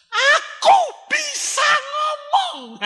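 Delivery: slow, high, loud joyful shout; exclaiming. Retold or imitated. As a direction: announce the miracle, overjoyed. Healed woman exclaims. (seruan gembira yang lambat dan tinggi)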